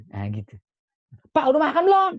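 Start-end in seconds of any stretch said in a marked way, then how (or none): 1.4-2.1 very high, loud shouted question; snapping yell. Shown as a demonstration. Child shouting at father whether he has eaten, harsh yell. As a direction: yell it at dad, as the wrong way. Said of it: "Jangan gitu".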